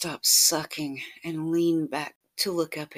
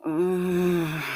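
moan; 3 semitones higher, 6 dB louder than usual. Acted. Lover moaning in the scene.

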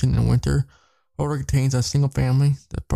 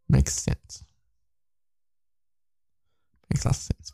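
whispered aside; 4 dB quieter than usual. Real, not acted. Unvoiced asides.

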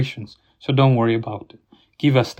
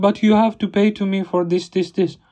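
mock angry clerk; 6 semitones higher than usual. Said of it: "And she's like".

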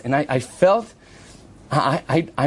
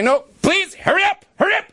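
urging hurry. Retold or imitated. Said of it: "And I said to her".

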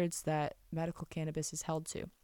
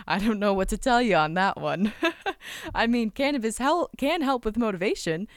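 louder, laughing through the words; laughing loudly; 4 semitones higher, 6 dB louder than usual. Real, not acted. Telling listeners cannabis helps motivation, laughing loudly. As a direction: laugh it out, a bit higher and loud.

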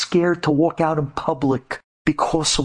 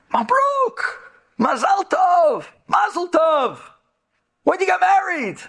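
excited congratulations; slower than usual. Real, not acted. Preacher meeting an old acquaintance on the street, high excited congratulations. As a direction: call out delighted congratulations.

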